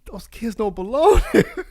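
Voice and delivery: high, loud, laughing through the words; slow, amused indignation; laughing outrage. Retold or imitated. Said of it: "I was like".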